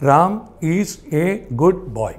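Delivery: very slow, a pause after each word. Shown as a demonstration. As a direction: separate "റാം", "is", "a", "good", "boy". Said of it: "അഞ്ച് വാക്കുകൾ മതി".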